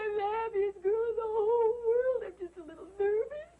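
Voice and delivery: a weepy bride insisting she is happy; happy crying. Acted. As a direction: wail through tears of joy. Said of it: "Why is she crying?".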